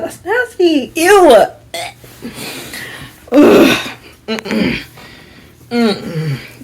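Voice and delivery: disgusted. Real, not acted. Disgusted at roommates.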